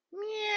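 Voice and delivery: very high falling cat yowl; cat meow. A narrator's reading. Cat loses balance.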